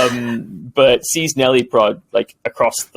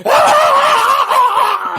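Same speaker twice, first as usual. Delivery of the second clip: high startled goblin shriek; 4 dB louder than usual. Acted. Goblin being surfed on by the player, high startled shriek. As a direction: yelp as if knocked off balance. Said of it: "the goblin's like".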